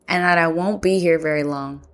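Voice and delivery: ordinary talk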